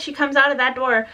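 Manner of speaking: ordinary talk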